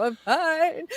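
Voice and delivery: laughing fake cheer when asked how she is; fake fine. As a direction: brush it off with a forced bright "I'm fine". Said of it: "I just go".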